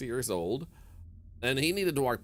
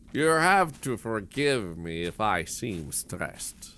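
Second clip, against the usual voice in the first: put-on slow, unhurried old man, lightly lower; very calm elderly man; 3 semitones lower, slower than usual. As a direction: imitate an old man utterly unstressed, drawing out each phrase. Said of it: "He said it in such a calm manner".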